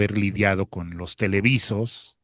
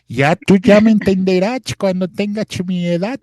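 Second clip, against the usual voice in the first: put-on higher, louder old man voice; little old man; 8 semitones higher, 5 dB louder than usual. Shown as a demonstration. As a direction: play a wise little old man lecturing. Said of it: "ahora sí ya estoy hablando como viejito". (voz de viejito sabio, más aguda y fuerte)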